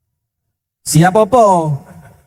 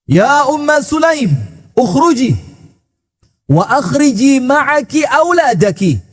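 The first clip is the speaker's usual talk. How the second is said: calling out; 7 semitones higher than usual. Acted. Abu Thalhah calling wife out.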